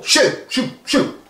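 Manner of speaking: high sharp shoos; teacher herding pupils; shooing. Acted. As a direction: shoo the crowd out. Shooing everybody out.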